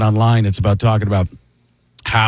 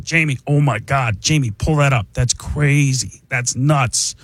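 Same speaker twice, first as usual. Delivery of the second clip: amazed podcast-host impression; Joe Rogan; slower than usual. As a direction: drag out the astonishment, pausing on it. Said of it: "it becomes Joe Rogan".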